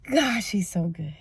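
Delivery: slow, whispered thanks; grateful whisper. Real, not acted. Speaker thanking God for her office, in a slow whisper. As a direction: breathe out thankful praise.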